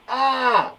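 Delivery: high, loud mock scream; surprised scream. Shown as a demonstration. Teacher telling of seeing a shark at the beach, surprised scream. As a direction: yelp as if startled. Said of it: "It's come as a surprise".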